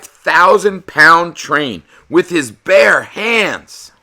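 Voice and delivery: awed storyteller shout; amazed exclamation. A narrator's reading. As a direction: wow the kids with this. Narrating amazing feat, shouted.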